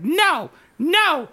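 hammed-up neighbor refusing from the lawn; imitating a yelling neighbor. Neighbor yelling at them on the front lawn, mock furious shouting. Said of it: "He's, like, yelling on the front lawn".

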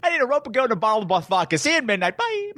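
high-pitched, breezy rattle-off; mock-casual, high sing-out ending on a squeaky bye; mock matter-of-fact. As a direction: toss off the list in a high voice. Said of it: "Just matter of fact".

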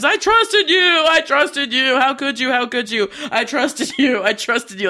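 high-pitched mock yell, easing off with each repeat; hammed-up yelling, fading as it repeats; imitated desperate yelling. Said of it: "he yells out".

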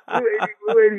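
high, sing-song quarterback cadence; snap count call. Retold or imitated. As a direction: bark out the count like a QB.

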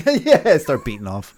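real laugh through the words. Real, not acted. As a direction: crack up while answering. Laughing at crude joke.

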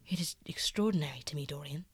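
lightly voiced painter, half-hushed and earnest; soft-spoken Basil. Basil confessing to Dorian, hushed and earnest. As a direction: let it drop low and confiding.

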